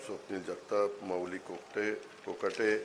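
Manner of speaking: usual speaking voice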